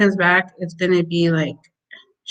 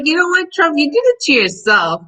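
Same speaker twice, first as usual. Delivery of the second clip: exasperated scolding; 7 semitones higher than usual. Retold or imitated. Scolding Trump in quote.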